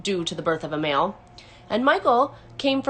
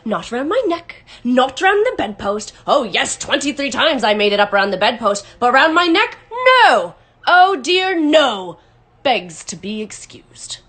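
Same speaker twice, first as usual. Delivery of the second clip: loud mocking rant, swooping high on key words; bitter sarcasm bursting into shouts, then dropping back; dangerously sarcastic; 4 semitones higher, 7 dB louder than usual. A narrator's reading. Father ranting to his wife about his tie, dangerously sarcastic. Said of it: "He became dangerously sarcastic".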